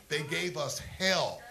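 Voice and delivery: slow, loud, each word hit; They gave us hell, slowed right down and punched; slow emphatic hammer. Acted. Repeated grievance slowed for emphasis.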